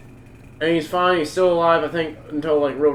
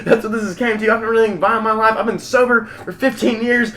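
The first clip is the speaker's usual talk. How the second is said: raised, worked-up mock rant; going off; 6 semitones higher, 4 dB louder than usual. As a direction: rant like the gunman justifying himself. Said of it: "He's, like, going off on all this shit".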